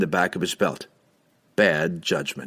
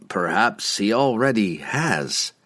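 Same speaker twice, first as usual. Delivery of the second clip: grim; slower than usual. A narrator's reading. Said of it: "Laval replied grimly".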